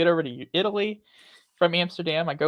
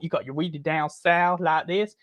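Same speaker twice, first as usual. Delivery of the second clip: southern accent. Shown as a demonstration. Showing one half of the blend, a southern accent. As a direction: put on a really down-south twang. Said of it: "your British accent and your southern accent".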